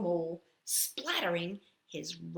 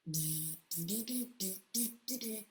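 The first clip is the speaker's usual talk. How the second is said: soft buzzing flies; 10 dB quieter than usual. Acted.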